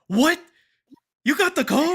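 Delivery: high, loud stunned question; shocked disbelief. Retold or imitated. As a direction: friends shocked he got the code, loud disbelief. Friends shocked at news.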